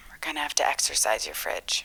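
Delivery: hushed whisper. Acted. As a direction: whisper it like a secret. Agent drops voice for exorcism.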